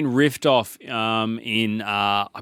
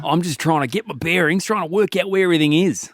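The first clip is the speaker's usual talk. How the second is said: broad Aussie; 5 semitones higher than usual. Pretend. Aussie bushman explaining himself to a New York cop in broad Aussie. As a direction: ham up a friendly ocker bushman. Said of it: "the accent is, uh, throwing me off".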